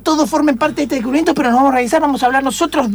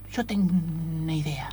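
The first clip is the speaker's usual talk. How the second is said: conspiratorial murmur to his partner; hushed; 10 semitones lower, 13 dB quieter than usual. Acted. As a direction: drop the voice to a secretive murmur. Secret plan shared aside.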